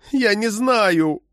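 high, drawn-out plaintive moan. A narrator's reading. Arrested woman pleads ignorance to the agent, moaning. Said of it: "простонала она".

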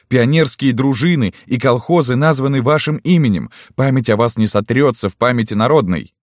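high, loud impassioned shout; heartfelt shout. A narrator's reading. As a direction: cry it out with passion. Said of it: "закричал в сердцах".

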